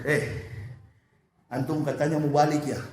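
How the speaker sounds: a hint of a low scolding angel; sneering angel. Angel taunting a dying hypocrite, low and mocking.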